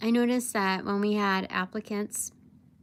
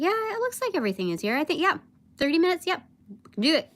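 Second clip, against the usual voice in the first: fast, higher, breezy yes; rushed confident reply; 7 semitones higher, much faster than usual. Retold or imitated. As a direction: rattle it off like it's easy. Confidently agreeing to deadline.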